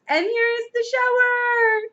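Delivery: high, sustained announcing shout; excited shout. A narrator's reading. Alcaloo with the hose, shouting. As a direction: sing out the big reveal. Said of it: "he shouted".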